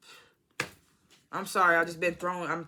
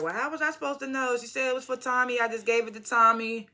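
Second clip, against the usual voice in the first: higher-pitched, mildly acted defensive quote; defensive retort; 7 semitones higher than usual. Vlogger retelling Kate's excuse to Tariq, defensive. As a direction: retell it as a protesting comeback.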